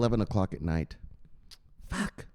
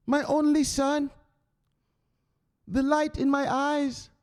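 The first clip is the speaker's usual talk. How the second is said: much higher, slow, adoring mama voice; doting mother; 3 dB louder, much slower than usual. Retold or imitated. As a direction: croon to your only son, drawn out. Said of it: "And his mama always says".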